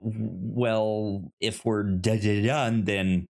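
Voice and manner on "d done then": normal talking voice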